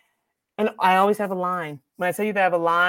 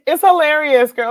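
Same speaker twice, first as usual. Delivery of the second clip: amused; 8 semitones higher, 9 dB louder than usual. Real, not acted. Laughing answer to interviewer.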